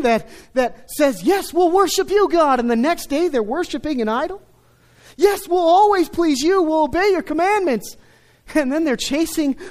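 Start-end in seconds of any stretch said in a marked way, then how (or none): 1.2-2.6 high, loud, overeager vow to God; sing-song fake devotion, pitched up; mock eager promise. Mimicking fickle Israel's promise. 5.2-8.0 high, loud, overeager vow to God; sing-song fake devotion, pitched up; mock eager promise. Mimicking fickle Israel's promise.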